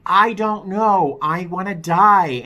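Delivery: loud, high, slow anguished scream; reliving a screamed plea for help; retold screaming. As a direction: scream it down the phone in despair.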